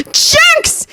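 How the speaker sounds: very high furious scream. Acted. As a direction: hurl the curse after them. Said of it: "he screamed".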